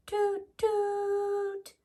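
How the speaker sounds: high, held horn toot; train horn toot. A narrator's reading.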